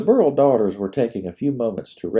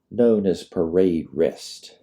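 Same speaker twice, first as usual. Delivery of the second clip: ordinary delivery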